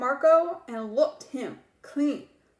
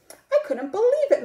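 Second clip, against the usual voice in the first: amazed, higher and louder; disbelief; 8 semitones higher, 6 dB louder than usual. Acted. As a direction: burst out in amazement. Excited storytelling peak.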